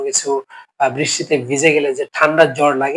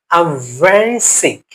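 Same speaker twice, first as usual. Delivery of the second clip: slow model phrase; much slower than usual. Shown as a demonstration. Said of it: "teacher models English phrase".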